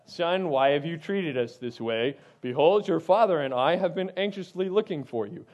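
low, measured, polite mother's reproach; mother asking with calm concern; calm formal mother. Pretend. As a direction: read it like a composed scripture mother. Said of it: "this is not a".

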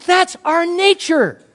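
loud, slow and high proclamation; emphatic declaration. Real, not acted. Preacher drives the point home.

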